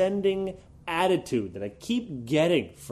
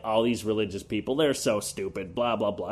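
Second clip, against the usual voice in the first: lower, lightly sneering; a hint of sneering atheist; 5 semitones lower than usual. Mocking arrogant atheists, lightly sneering. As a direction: dismiss them with a bored sneer. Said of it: "you know, like".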